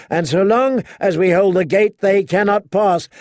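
high, loud mocking challenge; rallying shout. A narrator's reading. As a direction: rouse the troops with scorn. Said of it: "He was shouting now".

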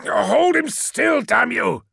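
high, loud, slow bellow; yelled order. A narrator's reading. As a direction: roar the order to hold him down. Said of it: "yelled Menkora".

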